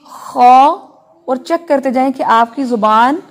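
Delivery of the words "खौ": खौ said loud with the tongue raised to the palate; heavy kho sound. Shown as a demonstration. Teacher models the heavy letter खौ for students. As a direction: give खौ a full, heavy back-of-mouth sound. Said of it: "साथ साथ अदा करते जाएं".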